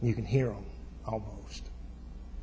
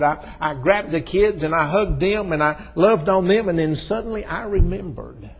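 loud, high, breakneck joy of a retold reunion; excited; 7 semitones higher, 9 dB louder than usual. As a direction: preach his excitement at full voice. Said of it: "I got so excited".

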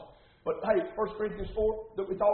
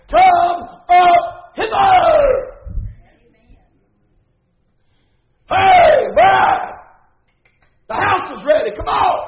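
huge, high, drawn-out summoning shout; commanding full-force call; shouted divine order; 10 semitones higher, 15 dB louder, much slower than usual. Acted. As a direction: holler it out, stretching the words to call from afar. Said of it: "He's coming back with an order".

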